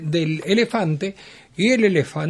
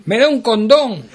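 louder, higher, shameless ask; a crude customer calling out across the counter; loud blurt; 5 semitones higher, 7 dB louder than usual. Retold or imitated. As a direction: blurt it out to the whole pharmacy. Loud customer in busy pharmacy.

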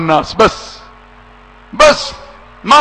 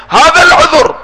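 ordinary talk, nothing marked

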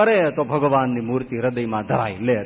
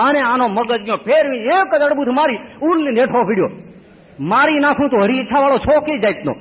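angry outburst; 7 semitones higher, 4 dB louder than usual. Acted. Devotee loses temper, slaps babaji.

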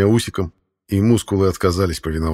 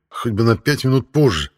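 plain reading voice

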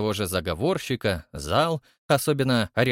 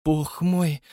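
ordinary delivery

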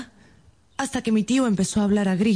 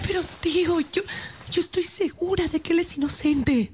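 pleading niece; 8 semitones higher than usual. Acted. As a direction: beg him to believe you. Niece pleads for Arturo's release.

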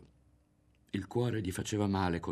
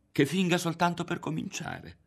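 much higher, wondering voice; high-pitched aside; 11 semitones higher than usual. A narrator's reading. Ario suspecting Berto is only pretending, high-pitched. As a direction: lift the thought high and light. Ario's thought, pitched far up.

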